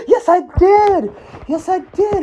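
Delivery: put-on high, pleading child's voice at full pitch; a boy protesting he earned it, voiced high; insistent kid voice. Student insisting to teacher, high pleading kid voice.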